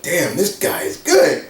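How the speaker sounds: lightly voiced Gunn, amazed at the fighter; impressed Gunn.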